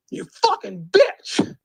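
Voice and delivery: high, slow mock yell; cursing battle rapper. Mocking a rapper who chose to man up, high-pitched hammed-up cursing. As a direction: draw the insult out in a high put-on voice. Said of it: "chose to man up and say".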